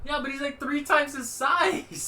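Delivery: high, slow disbelief; incredulous. Real, not acted. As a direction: protest in disbelief. Objecting to a comparison.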